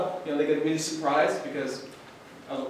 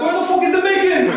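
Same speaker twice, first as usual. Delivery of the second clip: much higher and louder outraged demand; mock angry yell; 11 semitones higher, 6 dB louder than usual. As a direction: roar it like a cheated customer. Angry customer voiced.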